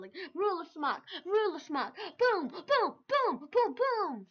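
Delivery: high, loud mock smack-and-boom noises; playful sound effects. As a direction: act out the hits with comic sound effects. Acting out ruler attacks.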